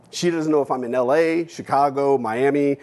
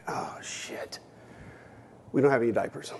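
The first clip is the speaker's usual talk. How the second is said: a hushed, breathless whisper of dread; horrified whisper; 8 dB quieter than usual. Retold or imitated. Boy alone with siblings, realizing there are no diapers, whispered. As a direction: whisper it as panic sinks in.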